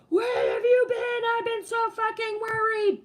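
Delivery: furious mom. Retold or imitated. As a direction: yell it at the late kid like a frantic mother. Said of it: "my mom is standing there like a fucking beast".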